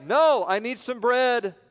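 insistent plea. Acted. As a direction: keep knocking with your voice. Friend insists.

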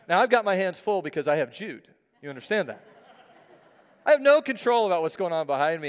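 normal talking voice

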